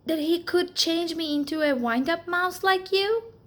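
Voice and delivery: hopeful little mouse. Acted. Alexander asks hopefully.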